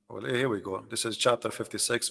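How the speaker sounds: usual speaking voice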